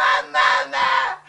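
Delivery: loud, slow wailing scream; anguished scream. Retold or imitated. Daughter screaming for her mother, anguished scream. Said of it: "I scream".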